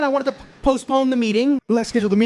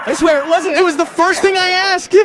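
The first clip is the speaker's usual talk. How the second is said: laughing insistence; 9 semitones higher, 6 dB louder than usual. Real, not acted.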